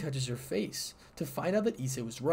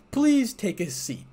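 welcoming host; 9 semitones higher, 7 dB louder, slower than usual. Acted. Sir Zex welcoming guests.

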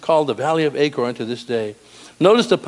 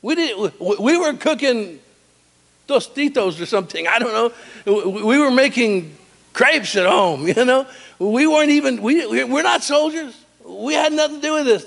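put-on very high girlish voice, indignant; protesting daughters; 10 semitones higher than usual. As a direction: squeal out that you were at home. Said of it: "His daughter's like".

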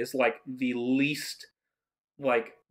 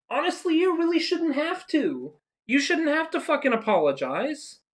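much higher, pleading exasperation; exasperated; 11 semitones higher than usual. Real, not acted. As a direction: stick up for him, fed up. Exasperated defending the actor.

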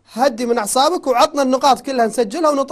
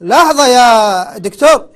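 loud interruption; 8 dB louder, much slower than usual. Real, not acted. Host cutting off caller.

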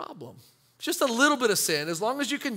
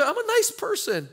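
"nice" pitched way up in a whiny protest; mock high protest; 12 semitones higher than usual. Believer protesting to the pastor, high and mock whiny. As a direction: squeak out "I'm a nice person".

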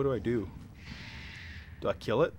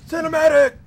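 mock announcer; 14 semitones higher, 10 dB louder than usual.

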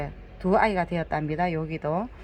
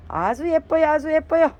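gushing; 7 semitones higher, 5 dB louder than usual. Real, not acted. Gushing over the plants.

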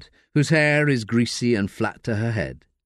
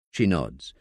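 ordinary delivery

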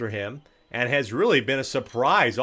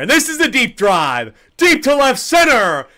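loud, high, drawn-out home run call; 7 semitones higher, 7 dB louder than usual. Acted.

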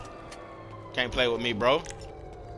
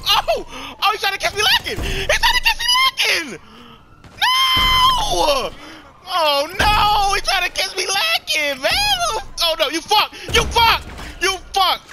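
high, louder laughing shrieks; real shrieking panic; 5 dB louder, slower than usual. Real, not acted. Gamer shrieking after an ambush, laughing in real panic. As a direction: squeal in half-laughing panic.

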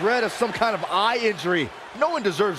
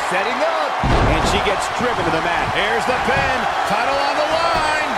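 excited play-by-play. Acted. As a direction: call the title win with building excitement. Title-winning finish called live.

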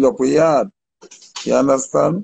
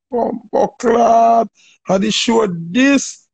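shocked outcry; 7 semitones higher, much slower than usual. Retold or imitated. Retelling his shocked reaction to film.

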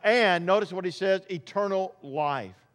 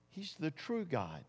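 much softer repeat, almost breathed; near hush; 2 semitones lower, 14 dB quieter than usual. Acted. Preacher repeating to the church in a hush. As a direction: let it sink in, barely spoken. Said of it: "repeat, near whisper".

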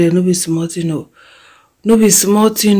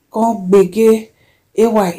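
ordinary talk

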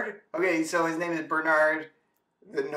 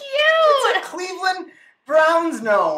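excited; 13 semitones higher, 9 dB louder, slower than usual. Real, not acted. Presenting gift, shouting through laughter.

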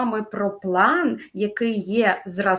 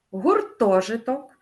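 slow "гуртожиток" with ТО stressed; slow down and hit ТО in "гуртожиток"; stress on second syllable. Shown as a demonstration. Teacher models correct stress, slow "гуртожиток" stressed on ТО. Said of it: "На другий склад падає наголос".

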